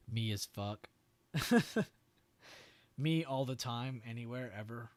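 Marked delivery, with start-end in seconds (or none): 1.3-1.9 short high laugh. Real, not acted. Reacting to chat joke.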